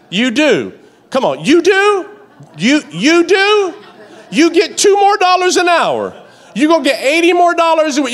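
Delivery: high, loud, laughing disbelief; mock the reasoning from the pulpit; laughing, incredulous preacher; incredulous preaching.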